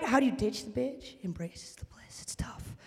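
ordinary talk

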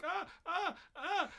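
high-pitched panicked ah ah ah; girls shrieking. Retold or imitated. Caught girls reacting to the teacher, high shrieks. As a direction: shriek in anime-girl panic. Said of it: "they're just like".

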